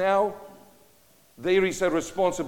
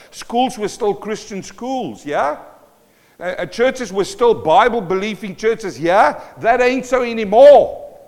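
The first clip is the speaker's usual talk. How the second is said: rising indignation; 5 semitones higher, 8 dB louder than usual. Acted.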